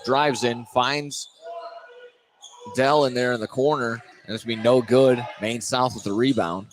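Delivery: normal talking voice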